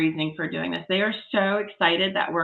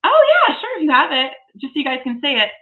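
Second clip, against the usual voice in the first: bright jump up to a much higher pitch for the photo; eager, raised voice; thrilled to show the building; excited; 8 semitones higher, 7 dB louder than usual. Real, not acted. Excited reply to photo offer.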